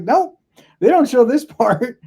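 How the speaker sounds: high comic disbelief at the movies; mock high outcry. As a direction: jump way up in pitch for a comic protest. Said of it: "I was like".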